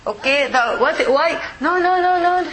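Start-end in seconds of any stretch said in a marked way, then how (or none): none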